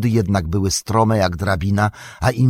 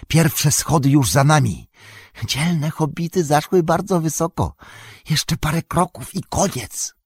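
higher, wheedling creature voice; Gollum voice; 6 semitones higher than usual. A narrator's reading. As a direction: creep the words out like Gollum. Gollum speaks to hobbits.

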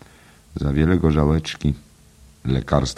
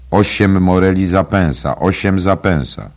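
loud, high hawker's cry; street seller's call; 6 semitones higher, 7 dB louder than usual. A narrator's reading. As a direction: call out the price to passers-by. Street seller calling out wares. (głośne nawoływanie ulicznego sprzedawcy)

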